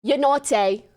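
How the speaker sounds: loud mock British accent. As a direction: scold teasingly in a British accent. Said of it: "He's U K".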